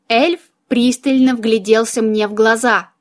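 ordinary delivery, nothing marked

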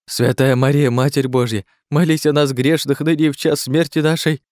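high-pitched frantic praying; panicked gabbled prayer. A narrator's reading. Klazen stares at the monster, gabbling a prayer. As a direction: rattle off the prayer in terror. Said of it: "затараторил Клазен".

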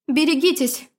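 sharp warning cry. A narrator's reading. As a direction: shout the warning. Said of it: "вскрикнула я".

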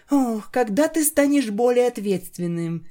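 put-on high, mildly scolding girl; female pony voice. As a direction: chide him lightly, high voice. Octavia scolding gently.

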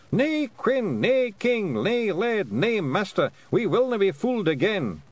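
defiant shouting of many wee men; crowd cry. Acted. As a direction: roar it like a rebel clan. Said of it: "drowned out by the cry".

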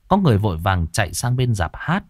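usual speaking voice

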